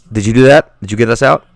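loud, thrilled question; can't believe the luck; excited. Acted. Player thrilled asking Shaman if he helped, loud. Excited after big play.

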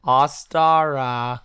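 OSTARA drawn out slowly; slow repeat. Acted. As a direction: stretch every syllable of "Ostara". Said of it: "is that how he repeated it".

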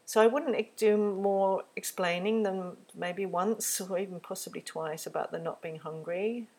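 ordinary delivery

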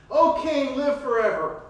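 sarcastic hail to the king. Acted. Daniel greeting the king with sarcasm. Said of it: "Maybe there was some sarcasm there".

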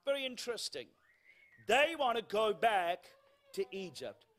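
usual speaking voice

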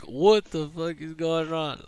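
slow, half-awake bewilderment; just woken and lost; drowsy mock confusion; groggy confusion. Waking up to commotion.

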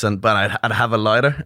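ordinary talk

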